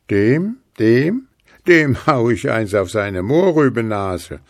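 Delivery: loud, stammering angry threat; a cross dwarf threatening the snowman; angry hiss. A narrator's reading. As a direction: sputter with fury. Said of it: "zischte Puh".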